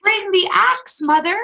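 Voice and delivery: high, loud urgent shout; Jack shouting. A narrator's reading. Jack shouting to his mother for the axe. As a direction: yell down for the axe in a hurry. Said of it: "shouted Jack".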